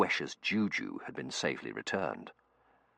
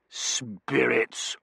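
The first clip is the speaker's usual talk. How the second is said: contemptuous; 7 semitones higher, 4 dB louder than usual. A narrator's reading. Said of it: "said contemptuously".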